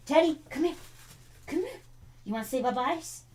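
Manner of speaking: high-pitched cooing to a dog; coaxing pet talk. Real, not acted. Owner invites Teddy to say bye-byes, coaxing. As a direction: coax the dog over in a high little voice.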